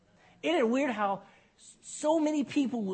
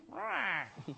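short, quiet bird squawk; mock parrot cry; 8 dB quieter than usual.

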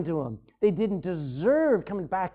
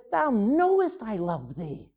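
a touch of weary exasperation, slow; mildly exasperated; 3 semitones higher, slower than usual. Retold or imitated. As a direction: let it sag out, tired of being asked. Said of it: "Peter was exasperated".

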